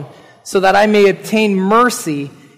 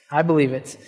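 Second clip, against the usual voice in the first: softer, lower, inward repeat of I believe it; soft affirmation; 5 semitones lower, 6 dB quieter, faster than usual. Real, not acted. Pastor quietly repeats his belief to the congregation, hushed and sincere. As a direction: say it again almost to yourself.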